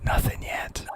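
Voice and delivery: conspiratorial whisper. Real, not acted. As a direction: whisper it like a secret. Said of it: "Why are we whispering?".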